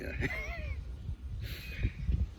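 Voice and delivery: breathless high-pitched giggle; high wheezing laugh. Real, not acted. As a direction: laugh helplessly at the joke. Laughing at own story.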